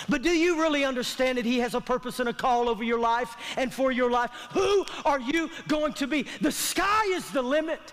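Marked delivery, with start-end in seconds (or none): none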